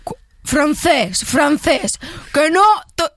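slow, loud, a touch rasped; mock rough Galician growl; raspy broken voice. Shown as a demonstration. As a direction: push the voice until it cracks.

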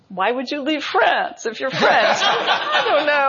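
high-pitched, laughing rhetorical question; laughing mock disbelief. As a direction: toss it out as a joke, breaking into laughter. Jokingly voices historians' stock answer.

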